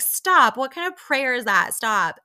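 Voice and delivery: loud, high cutting-in; mock protest. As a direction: imitate a group objecting at once. Acted group protest at a prayer.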